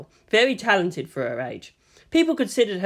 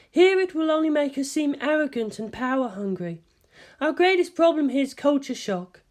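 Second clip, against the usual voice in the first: higher, composed scientist voice; Ritsuko's voice; 10 semitones higher than usual. A narrator's reading. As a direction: speak as the measured senior scientist.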